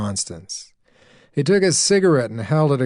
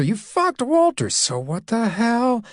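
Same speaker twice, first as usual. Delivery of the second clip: much higher, defiant woman throwing it back; defiant retort; 12 semitones higher than usual. Acted. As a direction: fling the words back at him.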